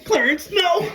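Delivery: high, loud shout of the line; shouted movie quote. Retold or imitated. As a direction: yell it like the movie scene. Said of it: "you do it, you do it".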